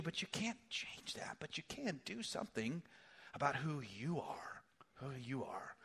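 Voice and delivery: soft whisper, leaning in; whispered aside. Real, not acted. Preacher whispering hope to the hurting congregation. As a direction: whisper it like a confidence. Intimate point to listeners.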